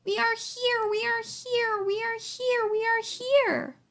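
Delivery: high, insistent chant, last one stretched; repeated high plea from tiny voices; crying out in fear. A narrator's reading. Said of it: "they cried out in fear".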